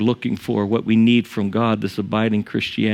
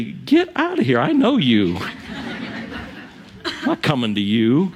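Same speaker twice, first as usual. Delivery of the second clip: mock dismissal; 3 semitones higher than usual. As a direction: scoff as if brushing off an absurd claim. Imagined congregation scoffs at preacher.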